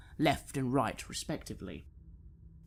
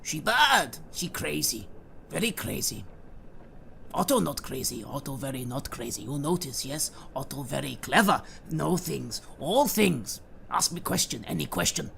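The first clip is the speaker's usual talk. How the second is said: eccentric henchman. Acted. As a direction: boast like a crude, odd servant. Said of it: "Otto shrugs".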